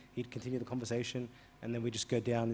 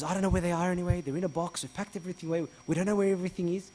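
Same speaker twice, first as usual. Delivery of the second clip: loud, higher, exasperated; hostile refusal; 6 semitones higher, 5 dB louder, faster than usual. Retold or imitated. Blind man fending off the pushy priest, loud. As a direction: brush him off angrily.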